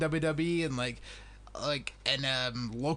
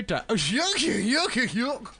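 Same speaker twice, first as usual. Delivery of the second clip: mock twang yuck; 6 semitones higher, 4 dB louder, slower than usual. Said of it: "it just goes from".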